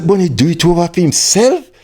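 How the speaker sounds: high, stunned echo rising; shocked question. Retold or imitated. Learning Bunny remade the song alone, stunned and high. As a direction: repeat it, stunned.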